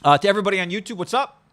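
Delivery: hype greeting. Real, not acted. Host hyping up YouTube viewers with a loud greeting. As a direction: pump up the YouTube crowd.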